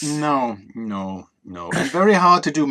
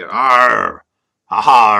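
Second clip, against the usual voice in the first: pirate arr; 3 semitones lower, 11 dB louder than usual. Pretend.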